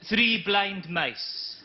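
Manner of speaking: slow solemn poem reading; poem recital. Acted. Comic reciting a poem to the audience, deadpan slow. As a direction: recite it very slowly, with gravity. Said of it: "a couple of poems".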